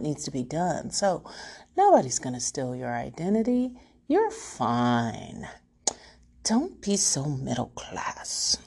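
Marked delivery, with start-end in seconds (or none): 1.8-8.6 self-scolding whisper. Real, not acted. Scolding herself to calm down.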